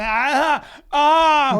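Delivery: high, loud mock moans; hammed-up moaning. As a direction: ham up the moaning. Faking sex moans for cars.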